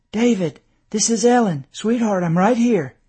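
worried wife reassuring. A narrator's reading. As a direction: reach a dazed husband and steady him. Wife urgently reassuring dazed husband.

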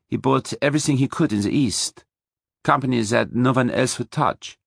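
put-on German accent, recounting family history frankly; woman's voice with German consonants; soft German accent. A narrator's reading. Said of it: "spoke in a soft German accent".

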